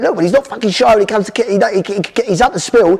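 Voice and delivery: high, animated mock dad pride; dad boasting. Dad boasting to mum about the boy's betting sums, high and animated. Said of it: "he went".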